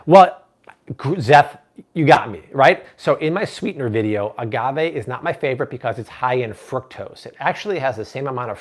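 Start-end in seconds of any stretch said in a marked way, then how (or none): none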